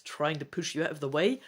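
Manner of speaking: usual speaking voice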